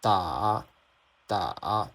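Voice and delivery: "打" low dip with a lift at the end; third tone. Shown as a demonstration. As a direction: drop "打" down, then bring it up. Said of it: "pay attention to the rhythm".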